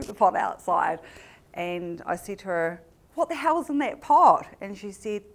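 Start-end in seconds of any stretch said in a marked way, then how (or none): 3.1-4.5 loud, high, outraged shout; furious yell. Retold or imitated. To the girl after throwing out the pot, furious yell. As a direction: blast the question at her in alarm. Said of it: "lots of swear words".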